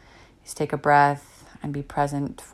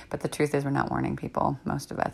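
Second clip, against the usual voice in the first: ordinary talk, nothing marked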